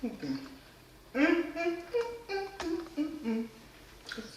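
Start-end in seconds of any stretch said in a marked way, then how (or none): 1.1-2.9 savoring hum. Real, not acted. Tasting her macaroni and cheese.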